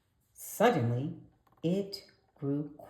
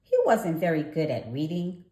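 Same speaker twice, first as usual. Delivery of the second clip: usual speaking voice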